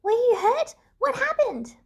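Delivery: put-on wide-eyed little girl blurting out worry; startled gasping questions; gasped. A narrator's reading.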